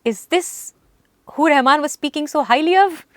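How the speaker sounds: high, sharp disbelief; scornful conductor. Retold or imitated. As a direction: sneer it like a disappointed boss. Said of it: "he's like".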